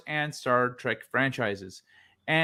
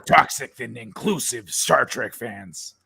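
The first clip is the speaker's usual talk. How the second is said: movie trailer voice; slower than usual. Acted. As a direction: read it like an epic movie trailer. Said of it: "That sounded just like the trailer guy".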